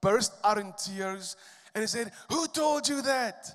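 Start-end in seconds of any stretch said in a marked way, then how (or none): 2.3-3.4 retold tearful outburst. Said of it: "he jumped up crying".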